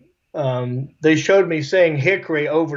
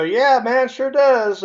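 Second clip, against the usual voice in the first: cheerful and higher, a friendly yes; good-mood answer; 7 semitones higher, slower than usual. Retold or imitated. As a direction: brighten up like you're in a great mood. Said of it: "If I'm in a good mood".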